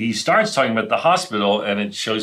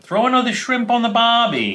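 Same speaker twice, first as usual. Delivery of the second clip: high-pitched, badly done Australian accent; hammed-up Australian, pitched way up; horrible Australian accent; 12 semitones higher than usual. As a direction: do a terrible mock Australian accent. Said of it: "like, a horrible Australian accent".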